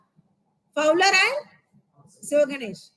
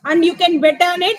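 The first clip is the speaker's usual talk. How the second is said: firm and louder, grim resolve; grimly; 2 semitones higher, 7 dB louder than usual. A narrator's reading. Teacher reading a character's threat grimly. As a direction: bet on it, hard and grim. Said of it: "he said grimly".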